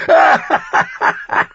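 high, loud guffaw; roaring laugh. A narrator's reading. King laughing at his own rant, roaring laugh.

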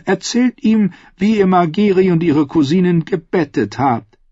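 high, commanding noblewoman; lightly voiced scheming queen. A narrator's reading.

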